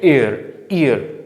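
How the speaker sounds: "ईयर" starting on a clear ee vowel, no y before it; vowel-first ईयर. Shown as a demonstration. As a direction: sound out "ईयर" from a pure ee. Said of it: "ये ई की आवाज़ दे रहा है". ("ईयर" की शुरुआत साफ़ ई से, य के बिना)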